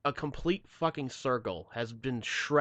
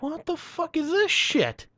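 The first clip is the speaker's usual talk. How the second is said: slow, very high-pitched retold disbelief; squeal-high protest, drawn out; incredulous outrage; slower than usual. Driver hearing towing is refused, incredulous.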